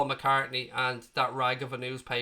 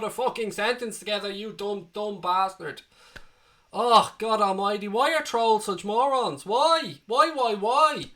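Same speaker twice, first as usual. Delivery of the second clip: rising exasperated groan, louder and higher; 5 semitones higher, 4 dB louder than usual. Real, not acted. Streamer fed up with his trolls, exasperated louder cry. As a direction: despair at stupid trolls, drawn out.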